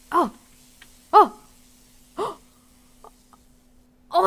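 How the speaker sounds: ordinary talk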